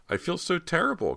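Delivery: very high, plaintive confession; distressed. A narrator's reading. Cody to Bo after people got hurt, high distress. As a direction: lament it in a high, upset voice.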